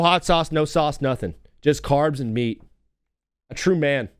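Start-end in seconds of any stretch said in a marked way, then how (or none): none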